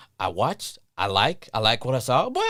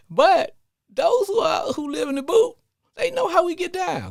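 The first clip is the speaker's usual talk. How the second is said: fired-up Louisiana pride; 4 dB louder than usual. Real, not acted. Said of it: "I do have a little Southern twang".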